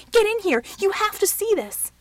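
high, loud frantic summons; urgent shout. A narrator's reading. Shaken man to helper, urgent shout. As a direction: yell for him to come at once.

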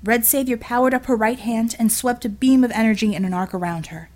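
ordinary delivery, nothing marked

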